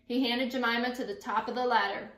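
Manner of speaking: usual speaking voice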